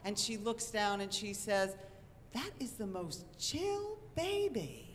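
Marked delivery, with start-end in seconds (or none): 2.3-4.9 high, softly drawn-out quote; slow, high-pitched friend voice; friend's awed remark. Retold or imitated. Friend admiring newborn in retold scene.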